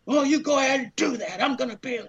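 high-pitched defiant comeback; arguing back. Retold or imitated. Climber daring lady to call cops, high and defiant. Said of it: "I'm arguing with her".

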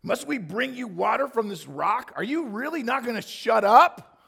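fed up, let it explode; exasperated yell. Acted. Moses yelling at the complaining people, exasperated shout.

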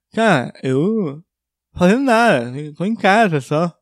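slurred nasal mumble through a mask; muffled fanho voice. Retold or imitated. Masked man says what he does in quarantine, muffled nasal voice. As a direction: imitate your own blocked-up, garbled speech. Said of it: "ele percebeu minha voz".

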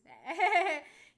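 loud, high burst of laughter; real cackling laugh. Real, not acted. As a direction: laugh out loud at your own story. Laughs at own retold reply.

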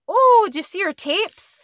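put-on high, loud Midwestern accent; Midwestern mom. Recapping Sam's mom offering to show tapes, exaggerated Midwestern accent. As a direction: gush like an excited Midwestern mom. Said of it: "I'm being Midwestern".

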